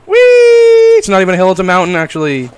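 getting worked up on the slide; excited. Real, not acted. Sliding down the mountain.

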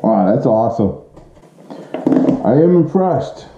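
usual speaking voice

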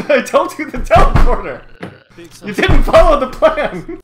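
loud, high, laughing reproach; half laugh, half yell at him; laughing scolding. Real, not acted. Scolding teammate who ignored plan.

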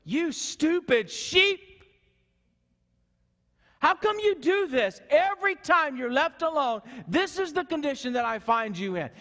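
exasperated shepherd yelling at a stupid sheep; angry shepherd scolding. Acted. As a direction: scream down at the foolish sheep. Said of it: "he doesn't stand over the sheep and go".

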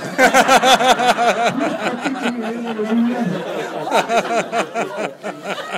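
loud high laughter; loud laughing. Real, not acted. After the chicken punchline, loud laughing. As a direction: crack up fully.

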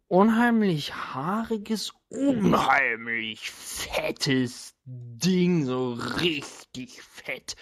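slow, drawn-out, savoring each word; relishing disgust. Acted. Game master describing the fat hairy creature, slow and relishing. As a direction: stretch it out like something gross.

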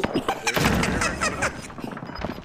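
high breathy laugh. Real, not acted. Laughing at a kill.

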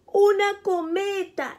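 light up at the gift; excited exclamation. Acted. Jackie opens the present and sees the kite, excited cry. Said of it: "exclama emocionado".

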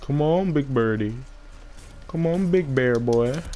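teasing taunt. Real, not acted. Taunting the boss.

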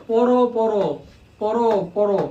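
পড়ো said with a clear final ও sound, mouth open; open o ending. Shown as a demonstration. Teacher contrasting পড়ো with পড় for students, clear o ending. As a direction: end পড়ো on a rounded ও. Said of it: "কিন্তু আমি যদি বলি পড়".

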